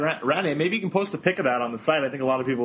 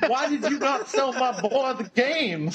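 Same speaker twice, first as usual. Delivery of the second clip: mock Southern dad, high and slow; a put-on Southern drawl, pitched high and slowed down; hammed-up southern accent; 10 semitones higher, slower than usual. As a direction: play an indignant Southern father in a put-on accent. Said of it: "I don't know why he has a southern accent".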